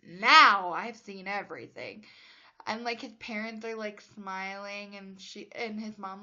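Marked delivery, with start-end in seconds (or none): 0.0-2.1 mock exclaim. Gerald quoted after the kiss.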